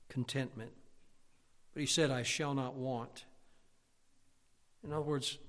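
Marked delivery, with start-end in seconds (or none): 2.1-3.3 "I shall not want" slowed, every word spaced; slow emphasis. Acted. Preacher dwelling on the verse for the church, slowly. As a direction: lay each word down deliberately.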